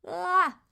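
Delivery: high, loud frustrated groan; frustrated wail. Real, not acted. Streamer groaning after forgetting her thought, exasperated. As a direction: let out an exasperated ah.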